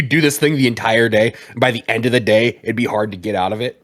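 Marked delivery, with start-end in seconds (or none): none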